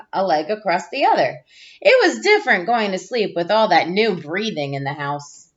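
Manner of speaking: put-on gentle storybook read-aloud for young listeners; reading to children. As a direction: read it like a teacher sharing a picture book with the class. Reads picture book to class.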